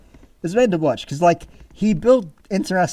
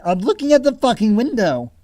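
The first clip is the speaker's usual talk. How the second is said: mock annoyed yell; 7 semitones higher, 5 dB louder than usual. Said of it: "I'm like".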